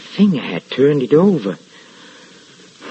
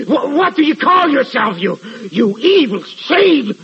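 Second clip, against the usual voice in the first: much higher, manic taunt; frantic challenge; 12 semitones higher than usual. Acted. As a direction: taunt the ghost, half-mad. Challenges the invisible being.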